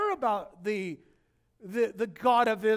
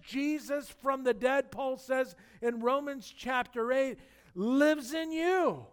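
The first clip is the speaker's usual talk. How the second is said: loud, rising sermon climax; loud, slow pulpit proclamation; preaching at full force; 4 semitones higher, 5 dB louder than usual. Acted.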